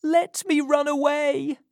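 put-on very high-pitched pleading boy; desperate child's cry, sustained high; crying out in fear. A narrator's reading. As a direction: plead to be released, frightened. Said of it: "cried Oliver".

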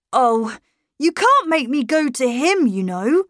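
put-on a boy refusing to be sent off; defiant boy. A narrator's reading. Boy tells governess she can't send him, defiant.

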